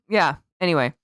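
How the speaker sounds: ordinary talk, nothing marked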